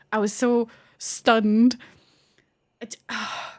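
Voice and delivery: ordinary delivery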